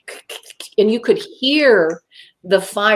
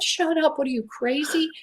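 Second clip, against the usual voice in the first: higher, softer incredulous rebuke; retold self-rebuke; scolding herself; 7 semitones higher, 7 dB quieter than usual. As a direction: tell yourself off in disbelief. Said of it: "I literally said that".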